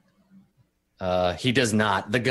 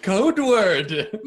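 high, laughing mock yell; 9 semitones higher, 4 dB louder than usual. GM jokes how security would alert the team, laughing mock yell. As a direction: holler it jokingly through a laugh. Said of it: "could yell really loudly".

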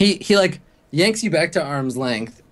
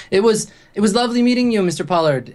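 higher, louder call over the shoulder, quick; 9 semitones higher, 4 dB louder, faster than usual. Acted. Kira calling back to Vince as she leaves, shouted farewell. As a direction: call back across a crowded bar. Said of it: "shouts to Vince".

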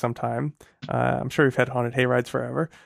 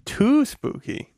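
slow drawn out; 10 semitones higher, much slower than usual. Real, not acted. Echoing, drawn out.

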